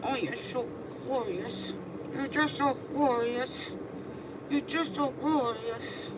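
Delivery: slow, soft cooing praise; tender praise. Real, not acted. Groomer softly praising the cat after her bath. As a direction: coo to her gently, drawn out.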